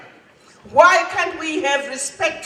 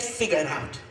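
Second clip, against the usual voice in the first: "Figure it out" dropped low and much softer, almost to oneself; quiet, weighty "Figure it out" in a much lower, softer voice; hushed repeat; 11 semitones lower, 10 dB quieter than usual. Retold or imitated. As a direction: let "Figure it out" fall away into a low, soft echo. Repeats the line, hushed for emphasis.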